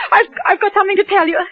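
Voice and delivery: shaky, stammering through tears; frightened wife, tearful, stalling for time. Acted. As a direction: plead through crying.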